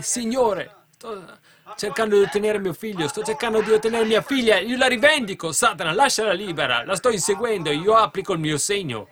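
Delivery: high, loud, urgent pleading prayer; believer's fervent prayer. Acted. Parent praying to the Lord for a daughter, fervent. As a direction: pray fiercely, claiming your child. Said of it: "Voi applicatelo e dite".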